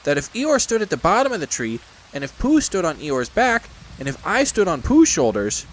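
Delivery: high, earnest little voice; earnest Piglet. Acted. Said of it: "said Piglet earnestly".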